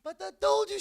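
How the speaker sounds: sudden high, loud outburst; furious coach yelling at beaten boys; coach's shout. Retold or imitated. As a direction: blast it like an angry coach. Coach scolding team after loss.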